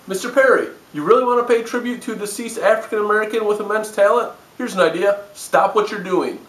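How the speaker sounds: mock direct address. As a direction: talk down to him.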